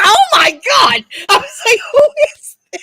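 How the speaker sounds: high, loud scandalized shout; shocked outcry. Retold or imitated. Answering the lewd caller, shocked and shouting. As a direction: cry out in shrieking disbelief.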